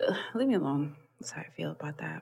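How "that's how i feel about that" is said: soft, fast whisper; whispered sign-off. Real, not acted. Ending her Tinder rant to her friend, whispered. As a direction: whisper it and wrap up quick.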